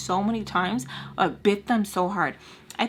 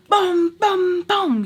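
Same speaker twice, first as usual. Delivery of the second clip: loud mock crash sounds; 11 semitones higher, 8 dB louder, much slower than usual.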